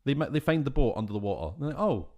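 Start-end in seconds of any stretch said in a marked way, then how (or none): 1.8-2.1 mock amazement. Mock discovery quote of divers.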